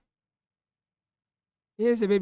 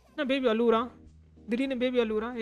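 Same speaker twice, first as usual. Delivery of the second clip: ordinary talk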